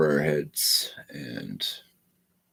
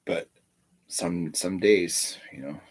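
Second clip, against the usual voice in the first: usual speaking voice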